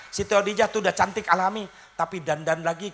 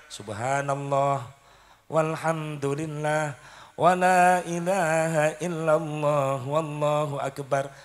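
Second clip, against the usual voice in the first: slow dhikr recitation; much slower than usual. Shown as a demonstration. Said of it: "Apa yang dibaca".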